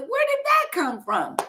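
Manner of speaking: high-pitched bewildered question; baffled disbelief. Retold or imitated. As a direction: re-enact being taken aback, pitch jumping up. Retold disbelief at the diagnosis.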